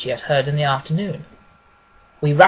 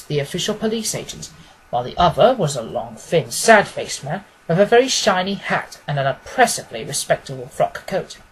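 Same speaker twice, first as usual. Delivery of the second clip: plain reading voice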